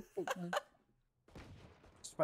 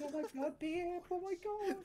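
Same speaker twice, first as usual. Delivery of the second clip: put-on much higher e-girl squeal; girly voice; 12 semitones higher, 4 dB quieter than usual. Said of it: "posing as a girl".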